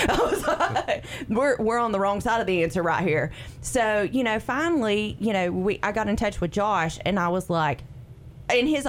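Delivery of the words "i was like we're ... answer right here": laughing through the words. Real, not acted. Talk show guest laughing about her doctor visit. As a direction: chuckle it out, amused at herself.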